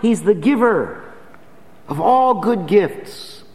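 preacher exhorting the congregation to count blessings; full-force preaching. Acted. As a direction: urge the listeners to believe. Preaching the application.

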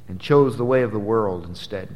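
usual speaking voice

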